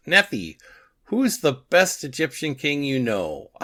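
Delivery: high, sing-out teasing; Crowther. Acted. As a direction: needle them with a cheeky question. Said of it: "Crowther is messing with Neferhotep".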